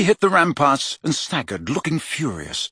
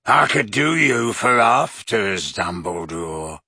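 lower, slow leering threat; leering werewolf; 4 semitones lower, slower than usual. A narrator's reading. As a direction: drool over the words.

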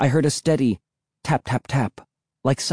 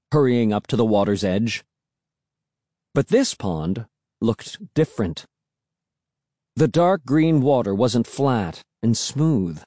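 ordinary delivery

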